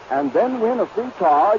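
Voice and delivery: usual speaking voice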